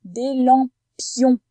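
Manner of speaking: "lampions" loud, slow, in separate syllables; syllable demonstration. Shown as a demonstration. Teacher points out the second syllable, "lampions" slow and emphatic. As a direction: stretch out "lampions", hitting each syllable. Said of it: "Deuxième syllabe aussi".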